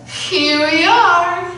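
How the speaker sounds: lightly voiced new bride; bride echoing. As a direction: echo him shyly.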